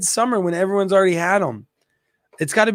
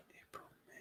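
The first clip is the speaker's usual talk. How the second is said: counting the months in a breathless whisper; 42 dB quieter than usual. Real, not acted. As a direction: whisper it to yourself while counting. Counting months under his breath.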